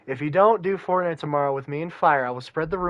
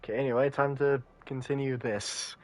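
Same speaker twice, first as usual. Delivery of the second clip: ordinary talk, nothing marked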